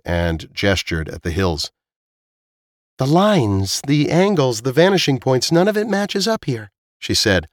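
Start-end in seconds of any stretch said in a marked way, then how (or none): 3.0-6.7 high-pitched, puzzled character voice; insistent Zila. Acted. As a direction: list each oddity, pitch climbing at the end. Voices Zila listing what's wrong.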